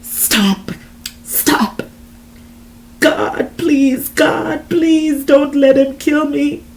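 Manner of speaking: high, desperate prayer; crying out. A narrator's reading. Desiree praying as she is attacked, desperate outcry. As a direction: cry out to God for rescue. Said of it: "I cried out".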